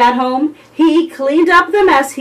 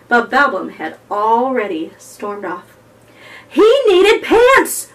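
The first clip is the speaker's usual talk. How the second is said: ordinary delivery, nothing marked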